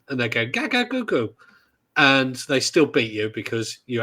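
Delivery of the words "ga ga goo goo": high-pitched imitation of baby talk; toddler babble. Mocking a three-year-old opponent online, toddler babble. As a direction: babble like a tiny child online. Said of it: "three years old online and".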